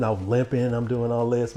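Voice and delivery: ordinary talk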